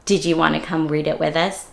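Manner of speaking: ordinary delivery, nothing marked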